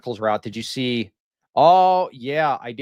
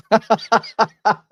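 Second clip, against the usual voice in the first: sudden high burst of laughter; loud laugh burst; 9 semitones higher, 6 dB louder than usual. Real, not acted. Streamer reacting to a donation with a loud laugh burst. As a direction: crack up out loud.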